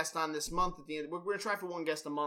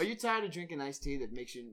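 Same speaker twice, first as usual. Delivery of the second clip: ordinary delivery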